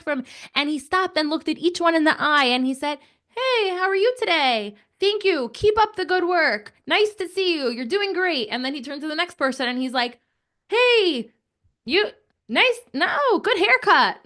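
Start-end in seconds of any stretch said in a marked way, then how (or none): 3.3-8.5 friendly boss greeting. Retold or imitated. Imitating boss greeting each worker. 10.7-14.2 friendly boss greeting. Retold or imitated. Imitating boss greeting next person.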